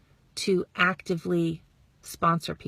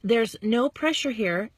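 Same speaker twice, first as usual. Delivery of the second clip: ordinary delivery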